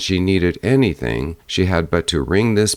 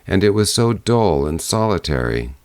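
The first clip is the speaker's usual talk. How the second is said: usual speaking voice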